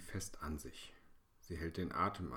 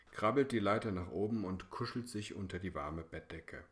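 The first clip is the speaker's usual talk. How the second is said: ordinary delivery, nothing marked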